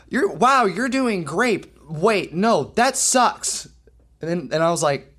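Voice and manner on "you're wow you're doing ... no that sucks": high, gushing praise flipped to a verdict; kid's supposed tic. Retold or imitated. Kid to drummer setting up, imitated sudden switch. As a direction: act out the kid's abrupt switch, pitched up. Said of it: "That's one of my tics".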